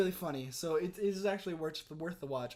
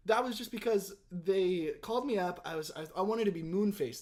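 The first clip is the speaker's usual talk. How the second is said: ordinary talk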